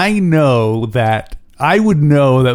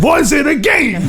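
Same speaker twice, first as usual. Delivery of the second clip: much higher voice, laughing; high mock voice; 13 semitones higher than usual. Joking with a cohost, high laughing voice. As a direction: play it as a giggling high voice.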